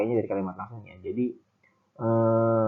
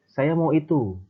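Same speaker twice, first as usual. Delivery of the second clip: firm; 6 semitones higher, 5 dB louder than usual. Shown as a demonstration. Teacher voices Anto stating what he wants, firm. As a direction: say it firmly, pitch raised and a bit louder.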